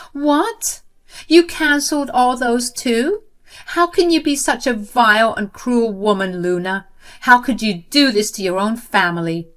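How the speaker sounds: high, loud, shocked outrage; outraged. Acted. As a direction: accuse her with fury. Sister outraged on phone.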